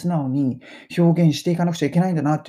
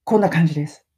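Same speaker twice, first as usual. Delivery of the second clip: normal talking voice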